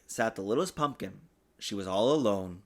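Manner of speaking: ordinary delivery, nothing marked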